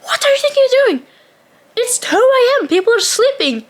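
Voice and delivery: high, fast, exasperated scolding; retold telling-off. Scolding the hair-drying girls at 2 AM, outraged. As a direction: tear into them, pitch way up. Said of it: "marching over to these two girls like".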